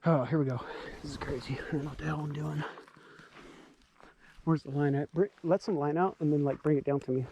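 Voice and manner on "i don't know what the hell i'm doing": normal talking voice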